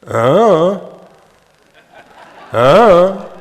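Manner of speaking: loud, high hum rising then falling; wordless "I don't know" hum. Shown as a demonstration. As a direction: hum just the tune of "I don't know", no consonants. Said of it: "only the tone pattern".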